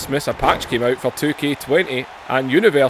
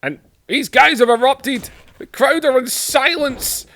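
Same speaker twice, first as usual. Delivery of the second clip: high, excited announcer calling the brawl; excited play-by-play; 4 semitones higher than usual. Acted. Calling Boss Man and Shamrock's fight, excited play-by-play. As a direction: sell every punch like live TV commentary. Commentating his wrestling game.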